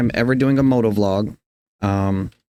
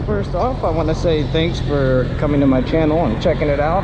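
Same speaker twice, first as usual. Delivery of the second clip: muffled through helmet mic; talking into helmet mic while riding; inside a helmet; 2 semitones higher than usual. Real, not acted. Moto vlog recorded inside helmet.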